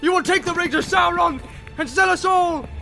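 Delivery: furious shouting. A narrator's reading. Boromir cursing the vanished Frodo, raging shout. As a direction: curse him with everything. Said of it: "he shouted".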